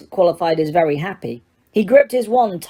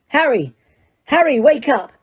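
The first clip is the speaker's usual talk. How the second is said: urgent calling; 6 semitones higher than usual. Acted. Lupin rousing Harry.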